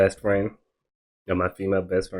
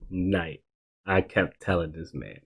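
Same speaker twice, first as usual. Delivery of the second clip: normal talking voice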